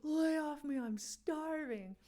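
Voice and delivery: a lighter retake of the Farley shout; half-voiced Farley impression; quick Farley quote. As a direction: toss off the line in a mock Farley voice. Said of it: "he says".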